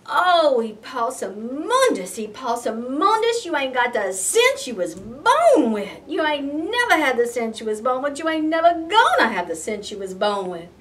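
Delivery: loud exasperated scolding, repeating the refrain; mama scolding. Acted. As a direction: scold him like a fed-up mama. Mama scolding her son.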